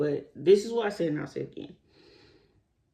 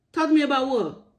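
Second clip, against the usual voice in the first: incredulous retort; 8 semitones higher, 4 dB louder than usual. Acted. Mocking rhetorical retort.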